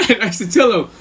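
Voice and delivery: ordinary talk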